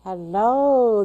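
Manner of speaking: normal talking voice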